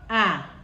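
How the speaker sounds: open, held ah sound; teacher modelling the ah vowel. Shown as a demonstration. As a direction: demonstrate the Chichewa ah clearly. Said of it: "Say it with me".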